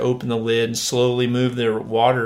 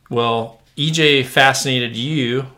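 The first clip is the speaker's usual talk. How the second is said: ordinary delivery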